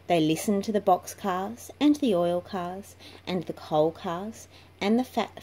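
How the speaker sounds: plain reading voice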